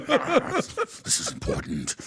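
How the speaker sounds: hushed, voiceless mock solemnity; imitated grave whisper; dead serious cultist. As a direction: whisper it like an entranced old man. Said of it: "Well, they're dead serious".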